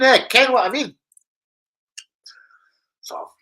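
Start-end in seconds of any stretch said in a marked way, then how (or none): 0.0-1.0 mock Scottish. Scottish phrase "ken.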